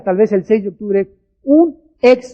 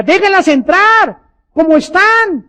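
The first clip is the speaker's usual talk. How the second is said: high, drawn-out insistent plea; emphatic appeal; 7 semitones higher, slower than usual. Retold or imitated. Preacher telling church brothers to admit newcomers, emphatic appeal. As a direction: urge it slowly, pitched well up.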